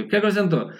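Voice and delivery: ordinary talk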